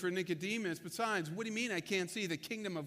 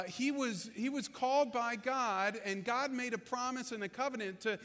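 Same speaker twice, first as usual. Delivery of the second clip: ordinary talk, nothing marked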